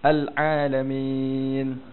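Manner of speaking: very slow "Al-Alamin" with a moderate Ain; a measured, balanced Ain in "Al-Alamin"; middle Ain. Shown as a demonstration. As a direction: say "Al-Alamin" with a balanced Ain, neither pressed nor lazy. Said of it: "Okey, cuba sebut".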